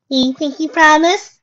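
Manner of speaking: high, slow questioning little voice; childlike filly voice. A narrator's reading. Sweetbell makes Applejack confirm her promise, in filly voice. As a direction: ask it high and drawn out.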